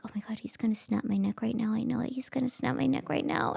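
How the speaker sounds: whispered, breathless panic; terrified whisper. Retold or imitated. Wife in bed fearing her husband will snap her neck, whispering. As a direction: whisper it in terror.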